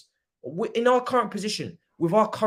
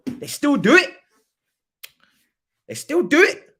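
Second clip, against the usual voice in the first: high, loud disbelief; incredulous outburst; 7 semitones higher, 6 dB louder than usual. Real, not acted. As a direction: burst out exasperated, pitched way up. Outraged disbelief at the hosts.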